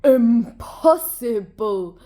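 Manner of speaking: slow, puffed-up scorn; puffing hippo. A narrator's reading. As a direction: Hippopotamus scoffing at puny Hare, slow and puffed up. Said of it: "puffed Hippopotamus".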